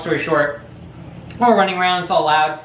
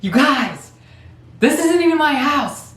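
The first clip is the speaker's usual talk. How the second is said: mock yell up the stairs; 10 semitones higher than usual. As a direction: yell upstairs that this isn't funny. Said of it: "he's, like, yelling up the stairs".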